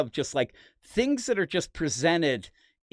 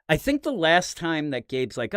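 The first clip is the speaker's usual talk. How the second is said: ordinary talk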